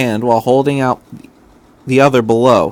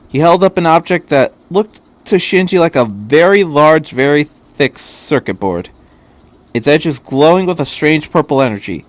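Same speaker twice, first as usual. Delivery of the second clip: plain reading voice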